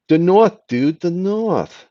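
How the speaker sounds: high, hammed-up northern English accent, drawn out at the end. Shown as a demonstration. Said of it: "a good day I can do the north".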